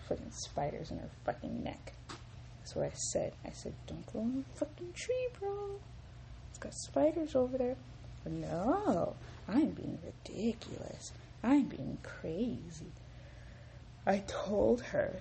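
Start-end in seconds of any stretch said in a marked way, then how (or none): 3.9-7.7 high-pitched, put-on warning voice; retold warning pitched way up; mock warning. Retelling own warning to someone. 8.2-12.9 mock dismissal. Mimicking being brushed off.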